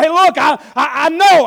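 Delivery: a hint of choked-up insistence; tearful Nehemiah. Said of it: "He said".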